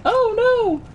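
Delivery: high falsetto mock wail; mock dismay. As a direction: squeal it in mock horror. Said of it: "he's just like".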